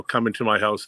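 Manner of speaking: ordinary talk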